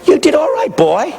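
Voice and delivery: a hint of a patronizing pastor; imitated pastor. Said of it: "my pastor squeezed my knee, he said".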